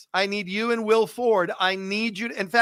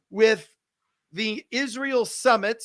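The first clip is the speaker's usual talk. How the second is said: normal talking voice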